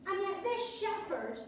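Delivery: ordinary talk, nothing marked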